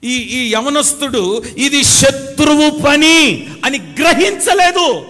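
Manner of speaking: high, loud, slow preaching cry; fiery preaching. Real, not acted. Preacher crying out to the congregation, full force. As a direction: proclaim it slowly over the music.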